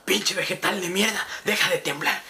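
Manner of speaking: lightly voiced drunk buddy grumbling; a hint of a sloppy drunk telling him off; drunk friend voice. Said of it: "se despierta ese güey a su peda y me dice".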